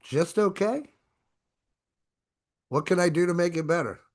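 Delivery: "okay" lifted high as a real question; questioning rise. Shown as a demonstration. Salesman showing the viewers how to query a customer, rising on "okay". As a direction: make "okay" a genuine question. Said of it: "Question mark".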